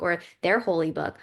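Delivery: usual speaking voice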